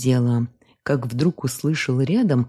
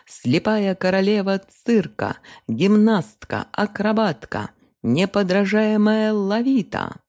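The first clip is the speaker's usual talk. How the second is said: loud carnival barker; 7 semitones higher than usual. A narrator's reading. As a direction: call out to the square like a circus barker. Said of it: "кричал цирковой зазывала".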